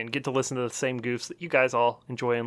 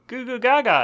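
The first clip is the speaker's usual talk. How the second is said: put-on baby voice; 8 semitones higher than usual. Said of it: "I just crawl up there and I go".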